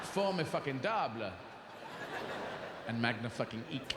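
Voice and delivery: jam fucking into the middle of French words; French with swear infix. Shown as a demonstration. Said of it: "I say".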